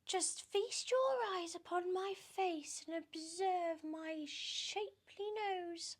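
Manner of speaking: very high, slow, preening voice; high, silly show-off voice; vain Aunt Sponge. Acted.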